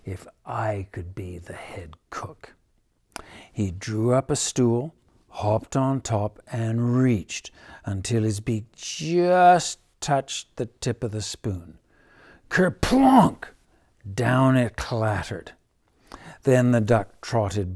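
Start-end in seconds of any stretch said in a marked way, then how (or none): 0.1-2.5 dreamy whispering duck. Acted. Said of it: "he murmured". 12.5-13.5 high loud kerplonk; crash sound. A narrator's reading. Sound word in story.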